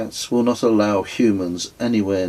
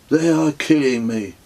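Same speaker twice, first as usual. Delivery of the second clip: big man's lament; 6 semitones higher than usual. Acted. Big bearded man complains of boots.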